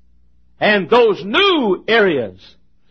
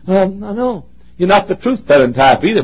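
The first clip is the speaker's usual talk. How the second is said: usual speaking voice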